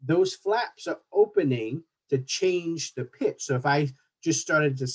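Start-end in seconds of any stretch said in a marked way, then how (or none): none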